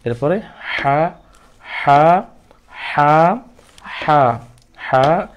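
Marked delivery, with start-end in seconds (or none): 1.6-3.4 হা pushed from the throat with a hiss; throaty হা from the throat; breathy throat ha. Shown as a demonstration. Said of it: "একটু ফেস ফেস আওয়াজ আসবে".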